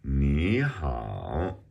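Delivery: "Ni hao", Ni rising, hao dipping; tone demonstration. Shown as a demonstration. Teacher reading the dialogue, "Ni hao" with rising then dipping tone. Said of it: "So it's a rising tone and then a third tone".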